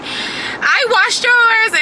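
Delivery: high, slow mock mom voice; mimicking mom. Teasing daughter about bath, imitating mom high and slow. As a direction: pitch way up and stretch it out like mom. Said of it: "She was like".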